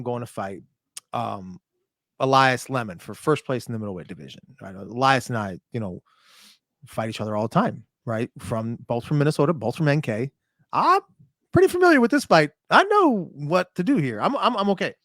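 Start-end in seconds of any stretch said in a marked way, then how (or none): none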